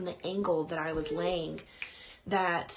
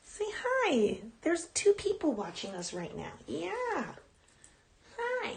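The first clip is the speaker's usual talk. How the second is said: pet talk; 9 semitones higher than usual. Real, not acted. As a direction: coo at the puppy to coax her over. Showing dog to viewers.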